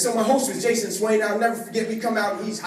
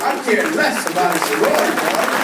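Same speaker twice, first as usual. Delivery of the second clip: gleeful shout; 7 dB louder than usual. Real, not acted. Gleeful about his own bed.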